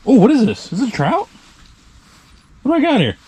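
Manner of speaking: surprised. Real, not acted. Angler feeling a big fish, surprised and eager. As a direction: ask it up high in wonder.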